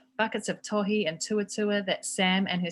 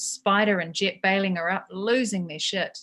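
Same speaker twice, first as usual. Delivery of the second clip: ordinary delivery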